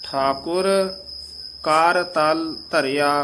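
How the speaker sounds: ਕਰ and ਤਲ as separate words, slow; ਕਰ ਤਲ apart. Shown as a demonstration. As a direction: split ਕਰ ਤਲ clearly. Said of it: "ਕਰਤਲ ਇਕੱਠਾ ਨਹੀਂ ਬੋਲ ਜਾਣਾ". (ਕਰ ਤਲ ਵੱਖ ਵੱਖ)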